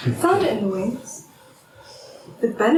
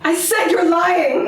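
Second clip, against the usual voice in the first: raised, drawn-out angry accusation; insistent accusation; 5 semitones higher, 4 dB louder than usual. Acted. Doubting partner repeats the charge of lying, angrily insistent. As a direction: press it harder, higher and slower.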